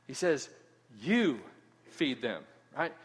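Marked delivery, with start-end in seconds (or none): 1.0-2.4 "You" set apart and pressed, then a soft low "feed them"; stress on YOU. Shown as a demonstration. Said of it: "Emphasis on the "you".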